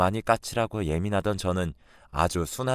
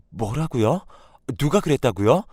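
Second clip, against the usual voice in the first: loud, higher cry of disbelief; shocked wife; 5 semitones higher, 5 dB louder than usual. Acted. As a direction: snap back in stunned disbelief. (믿을 수 없다는 듯 놀라 되묻는 아내)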